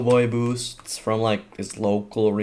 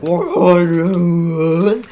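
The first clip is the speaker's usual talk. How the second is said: loud, high surprised what; surprised shout; 7 semitones higher, 9 dB louder than usual. Retold or imitated. Teacher coming back to the fight, a loud shocked what.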